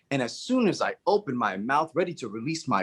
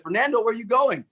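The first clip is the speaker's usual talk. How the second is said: calling after; 8 semitones higher, faster than usual. Retold or imitated.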